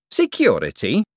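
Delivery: sample "Security" like an unfamiliar flavour; tasting the word. A narrator's reading. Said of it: "as if tasting the word".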